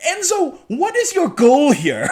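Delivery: high, slow, laughing demand; amused exasperation. Pretend. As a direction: plead with a maddening character, half laughing. Exasperated at the character's contradiction.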